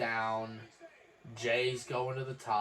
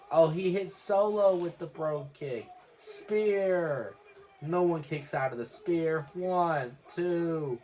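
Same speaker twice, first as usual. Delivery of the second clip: excited play-by-play; 5 semitones higher, 5 dB louder than usual. Real, not acted. Live calling of the finish.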